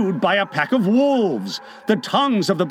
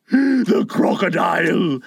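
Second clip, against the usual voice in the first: frightened villain's gasp; sudden gasped alarm; horrified gasp. A narrator's reading. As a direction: gasp it out in dread. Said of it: "he gasped".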